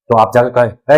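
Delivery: ordinary talk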